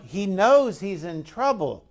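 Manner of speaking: usual speaking voice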